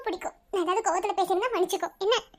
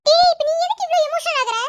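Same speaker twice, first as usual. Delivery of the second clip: loud, high-pitched telling-off; sharp, raised protest; exasperated scolding; 8 semitones higher, 6 dB louder than usual. Acted. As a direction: rein him in with a raised voice. Scolding an over-emotional student.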